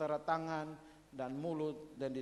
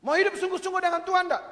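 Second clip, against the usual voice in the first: loud challenge; 13 semitones higher, 11 dB louder, faster than usual. Acted. Acted pastor challenging sinner.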